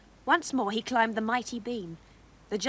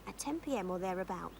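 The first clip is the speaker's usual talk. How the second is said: ordinary delivery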